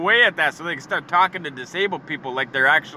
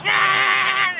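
high, drawn-out mock wail; mock moan; 4 dB louder than usual. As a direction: let out a long high "aaah". His acted reply to the patronizing greeting.

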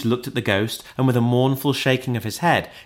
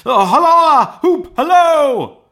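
very high, loud whoops; exuberant hallooing; 10 dB louder, slower than usual. A narrator's reading. Scrooge hails his boyhood heroes, whooping wildly. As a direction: holler it out with abandon.

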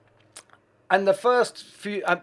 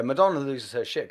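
normal talking voice

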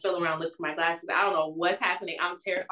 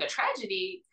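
retold incredulous question, higher pitched; confused echo; 7 semitones higher than usual. Questioning the date's word tragedy, retold confusion. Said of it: "Now I'm, like, super confused".

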